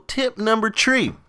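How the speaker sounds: high-pitched "three" with the th turned into t; three as "tree". Pretend. Vlogger announcing the next tip, "three" pronounced "tree". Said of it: "I said tree".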